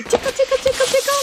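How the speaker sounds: put-on high singsong baby talk; giggly tickle attack. Shown as a demonstration. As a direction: coax the baby to say it, high and playful.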